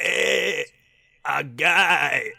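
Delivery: mock Spanish villager yell; Spanish ganado impression in Spanish: grito de aldeano español exagerado. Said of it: "trying to be one of those Spanish guys".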